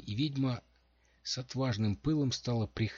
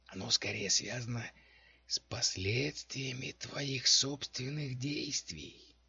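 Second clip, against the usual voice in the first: hoarse whisper; 5 semitones higher than usual. A narrator's reading. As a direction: rasp it out like a hidden necromancer. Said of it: "хриплый шёпот из темноты". (хриплый шёпот из темноты)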